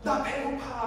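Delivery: put-on high, whiny complaint as a baby; fussy hungry baby. Hungry baby fussing at parents, whiny annoyance. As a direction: lightly imitate an irritated infant. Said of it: "하면서 짜증내는 거 아닙니까".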